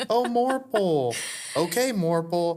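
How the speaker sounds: high, slow singsong cartoon voice; sing-song it like a kids' show host.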